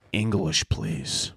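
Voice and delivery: low, hushed interrogator; quiet mock menace. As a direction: demand it softly and low. Acted interrogation of a squawking seagull.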